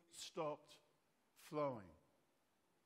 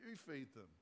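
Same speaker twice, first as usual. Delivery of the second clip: lightly cheeky retort; sassy Jesus; 2 semitones lower, 6 dB quieter than usual. Acted. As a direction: hand the problem back with mild sass. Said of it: "I love it when Jesus gets sassy".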